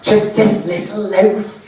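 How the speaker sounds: put-on high, slow little-old-lady voice; old woman. Hopeful old woman at the till, high and slow. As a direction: plead slow and sweet like a frail granny. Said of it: "And she's like".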